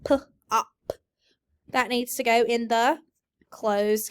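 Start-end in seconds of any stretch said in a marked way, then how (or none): 0.1-0.9 P-op split into onset and rime; sounds split. Shown as a demonstration. Teacher segments sounds for students. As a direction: break pop into p and op.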